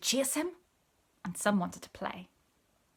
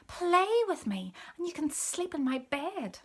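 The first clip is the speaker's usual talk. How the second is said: kitten voice; 8 semitones higher, 4 dB louder than usual. A narrator's reading. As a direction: coax him like a small kitten. Kitten pleading again.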